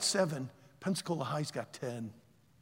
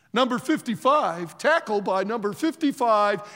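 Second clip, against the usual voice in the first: loud stadium announcer imitation; 4 semitones higher, 9 dB louder than usual. Announcing the tackler over the stadium PA, loud announcer call. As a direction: call it out louder over the loudspeaker. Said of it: "Over the P A, it says".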